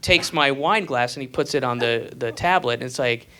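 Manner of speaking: ordinary talk, nothing marked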